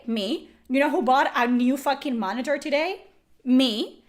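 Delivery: usual speaking voice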